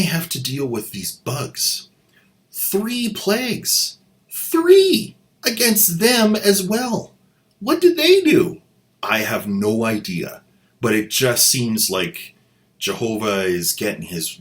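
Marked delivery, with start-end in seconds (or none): 2.5-8.6 indignant disbelief. Acted. Outrage at plagues hitting Israelites.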